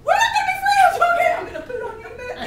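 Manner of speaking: high, wailing comic shout; mock hysterical outburst. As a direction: shriek it, then rattle off the end fast. Comic outburst at the other runner.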